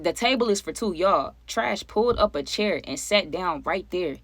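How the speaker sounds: usual speaking voice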